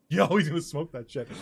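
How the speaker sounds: usual speaking voice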